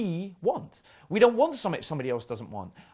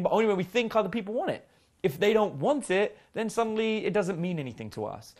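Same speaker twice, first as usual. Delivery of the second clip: normal talking voice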